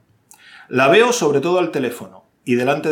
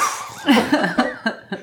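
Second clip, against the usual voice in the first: laughing; 7 semitones higher than usual. Real, not acted. Laughs at own French.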